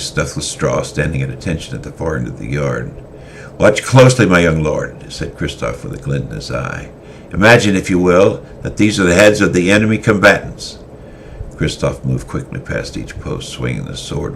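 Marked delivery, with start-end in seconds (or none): 3.6-5.1 a callous veteran scolding his tardy lord; stern old swordmaster. Acted. Christoph demonstrating. 7.3-10.8 louder, commanding instructor voice; a hardened warrior teaching a young lord to kill; stern swordmaster teaching. Acted. Christoph's voice.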